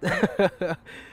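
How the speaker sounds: loud burst of real laughter; big laugh at a bold answer; hearty laugh. Real, not acted. Host laughs at guest's ambition.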